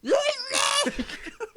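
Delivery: high wordless mock yell; comic high-pitched shout. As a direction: yelp out a hammed-up meltdown. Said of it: "That's a good [name]".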